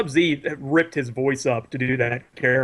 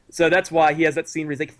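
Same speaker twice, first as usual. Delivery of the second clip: usual speaking voice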